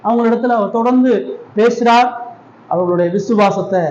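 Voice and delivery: normal talking voice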